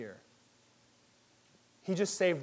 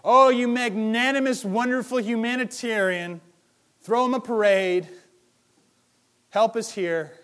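hammed-up fawning, higher, louder and slow; mock gushing praise; 6 semitones higher, 7 dB louder than usual. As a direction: gush sarcastically like a fawning fan. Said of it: "retweet it and say".

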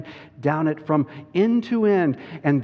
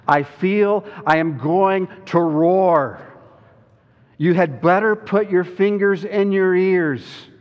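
loud, raised lion's declaration; Aslan about to roar; 6 semitones higher, 6 dB louder than usual. A narrator's reading. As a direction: build to a big booming warning. Aslan warns he will roar.

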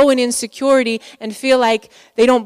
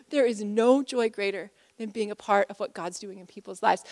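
ordinary talk, nothing marked

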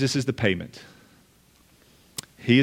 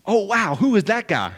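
higher and brighter, wide-eyed wonder, the wrong reading; mock excited happy tone; 7 semitones higher than usual. Shown as a demonstration. Preacher demoing how the Pharisees did not sound, excited. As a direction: gush it like a starstruck fan. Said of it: "they're not using, like, this exciting, happy tone".

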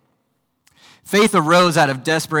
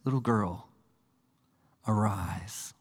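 tender, quiet Jesus voice, softer; gentle, soft; 4 semitones lower, 8 dB quieter, slower than usual. Acted. Jesus taking the little girl by the hand, tender and soft. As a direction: say it like waking a child gently. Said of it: "sweet, gentle moment".

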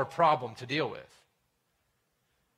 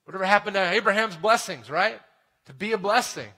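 higher and louder, a disbelieving exclamation; incredulous listener; 5 semitones higher, 5 dB louder than usual. Retold or imitated. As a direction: voice the shocked listener. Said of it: "You might be thinking".

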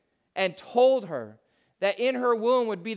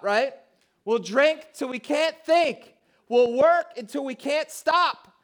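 rising, insistent sermon voice, louder and higher; preaching at full force; 4 semitones higher, 3 dB louder than usual. Acted. Pastor rousing the church about chasing the culture, full preaching. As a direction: drive it home to the congregation like a preacher.

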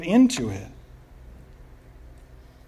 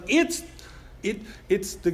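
much higher, tripping over the words; excited stammer; 5 semitones higher than usual. Retold or imitated. Peter spotting Jesus on the water, high excited stammer. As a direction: blurt it out, too excited to finish.

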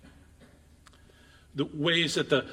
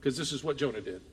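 ordinary talk

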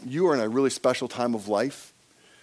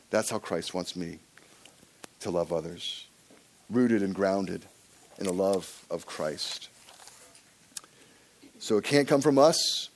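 normal talking voice

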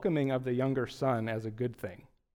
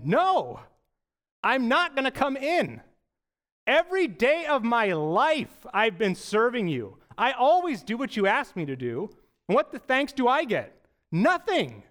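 higher and louder, protesting hard; indignant refusal; 7 semitones higher, 3 dB louder than usual. Pretend. Older son refusing his pleading father, indignant and loud. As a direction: throw the grievance back at your father.

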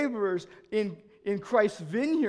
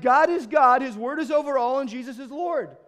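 loud declaration; 5 semitones higher, 7 dB louder than usual. Retold or imitated. Replying to stranger.

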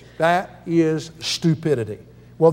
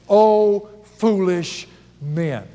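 slow, solemn rebuke; reprimanding; much slower than usual. Acted. Jesus rebukes two of his disciples, slow and solemn. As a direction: draw out the rebuke of Jesus, slowly. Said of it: "reprimands two of his disciples".

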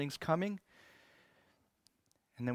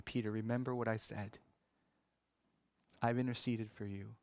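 tender reassurance; 6 dB quieter than usual. Acted. Jesus to the broken Peter, softly reassuring. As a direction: comfort a broken friend.

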